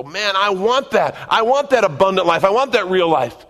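high, loud, fervent exclamation; eager longing. Acted. Believers reading the promises in Scripture, loud eager longing. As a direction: cry out with hammed-up yearning, drawing it out.

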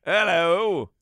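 loud, drawn-out British "Hello"; British accent hello. Pretend. Host repeating the British hello loudly. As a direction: stretch out a mock British "Hello". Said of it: "Oh, that was good".

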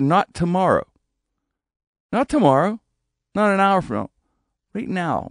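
high, loud angry ultimatum; angry demand. Retold or imitated. Teller re-enacts demanding a sign from Therese, angry. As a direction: raise pitch and volume, hammering out each demand. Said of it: "I said it a lot more angry".

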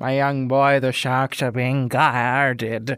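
slow, drawn-out mock-biblical proclamation; biblical shepherd. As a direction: proclaim it like a pretend old shepherd. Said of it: "like an old biblical shepherd".

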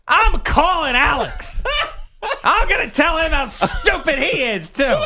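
angry voicemail. Retold or imitated. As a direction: threaten to tell on her. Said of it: "leaving angry voicemails to her".

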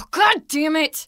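loud exasperated curse; frustrated outburst. Acted. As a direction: sigh then loud slow curse. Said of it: "Dude, calm down".

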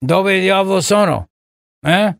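mock Biden trying out Italian; Joe Biden. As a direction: play a befuddled president in Italian, tacking on a puzzled grunt. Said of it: "you gotta slow it down for Biden".

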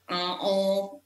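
a drawn-out nasal "uh, oh"; nasal French sound. Shown as a demonstration. Showing listeners the nasal French accent she lacks, nasal "uh, oh". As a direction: push "uh, oh" through the nose like a French vowel. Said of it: "don't have that nasal".

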